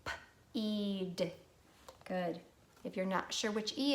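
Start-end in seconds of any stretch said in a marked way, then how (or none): 0.0-1.3 "P-eed" with a lone p, then stretched eed; sounded out. Shown as a demonstration. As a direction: detach the p in "P-eed", hold the vowel. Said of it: "Sound it with me".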